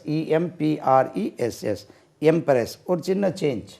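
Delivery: usual speaking voice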